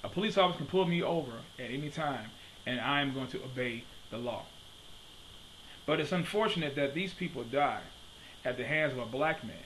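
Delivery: ordinary delivery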